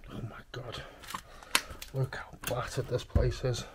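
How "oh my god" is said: breathless whispered "Oh my God"; awed whisper. Real, not acted. Explorer whispering as he sees the wrecked corridor. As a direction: gasp it under your breath. Seeing battered room while exploring.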